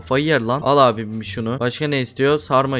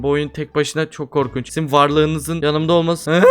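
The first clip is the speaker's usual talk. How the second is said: usual speaking voice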